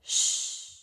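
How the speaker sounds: soft unvoiced shush; playful shush. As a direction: hush them before a secret. Hushing before the secret.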